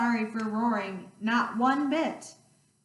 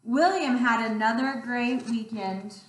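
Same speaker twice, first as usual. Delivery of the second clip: usual speaking voice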